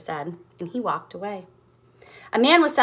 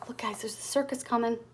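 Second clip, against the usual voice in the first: whisper; 9 dB quieter than usual. Real, not acted. Telling children a secret.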